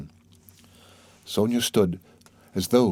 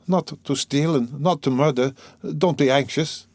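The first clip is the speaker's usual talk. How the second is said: higher, wry reassurance; bitter smile; 6 semitones higher than usual. A narrator's reading. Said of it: "he smiled bitterly".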